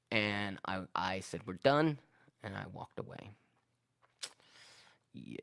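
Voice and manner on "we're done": a louder, firmer cut-off; snapping at him. Retold or imitated. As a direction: end the argument with a sharp final word. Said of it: "I lost my shit".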